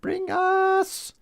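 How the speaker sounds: very high, slow squeaky knight voice; knight who says Ni. Retold or imitated. Streamer quoting the shrubbery knights, very high and slow. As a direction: squeal it like a silly Monty Python knight.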